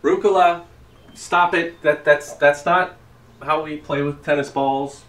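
loud, high parental telling-off; scolding child. Pretend. Dad scolding his daughter for misusing tennis balls. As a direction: sharply correct the kid from across the park.

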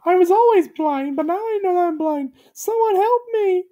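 very high, mock tearful cry; squeaky hammed-up plea; Gumby's high pleading voice. A narrator's reading. Gumby begs for help, very high voice. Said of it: "he cried".

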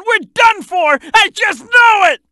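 high, panicky wail of doom; panicked outcry. Acted. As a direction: cry out in hammed-up despair. Doomed as place falls apart.

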